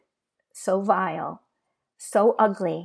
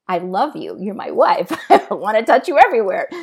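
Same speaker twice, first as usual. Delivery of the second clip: loud, higher-pitched mock pleading with a laugh; mock husband's plea; 3 semitones higher, 11 dB louder than usual. Wife imitates her husband protesting his love, loud mock pleading. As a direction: playfully act out an exasperated husband, getting louder.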